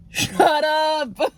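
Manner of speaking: high, overwhelmed "Shut up!" cracking with emotion; excited squeal. Real, not acted. Opening a gift from Emily, a high teary squeal. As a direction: squeal it out, near tears with delight.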